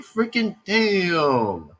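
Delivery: long drawn-out retold curse; mock dismay. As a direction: stretch the last word slowly in mock disbelief. Retold dismay at laptop failing.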